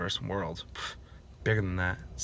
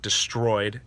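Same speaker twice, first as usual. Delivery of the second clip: normal talking voice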